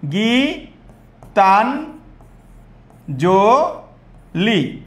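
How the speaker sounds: গীতাঞ্জলি split into four long, separate syllables. Shown as a demonstration. Teacher counts syllables for students, syllable by syllable. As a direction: stretch গীতাঞ্জলি out with a pause after each syllable. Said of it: "এইভাবে যদি দেখো".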